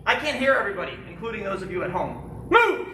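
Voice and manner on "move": drill command shout. Real, not acted. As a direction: snap the command out to the class. Instructor calling drill commands.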